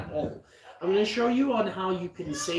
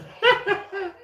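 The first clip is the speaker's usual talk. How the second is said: loud high-pitched giggle; high squealing laugh; 6 dB louder than usual. Real, not acted. Laughs at the wrong sound.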